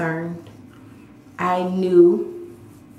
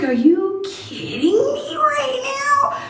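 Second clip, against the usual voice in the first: high, slow, disbelieving; incredulous anger; slower than usual. Retold or imitated. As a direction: stretch it out, pitched way up in disbelief. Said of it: "I was so mad".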